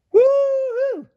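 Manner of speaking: high, loud whoop; shouted woo-hoo. A narrator's reading. The rancher whoops after the runaway cookie, loud. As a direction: let out a big cowboy whoop. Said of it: "shouted the rancher".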